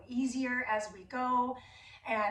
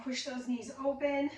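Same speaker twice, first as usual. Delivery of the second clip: normal talking voice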